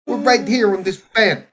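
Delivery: high, loud hammed-up mob boss; mock Jersey mobster. Mob father at his daughter's wedding, hammed-up Jersey accent. Put-on northern mobster bit.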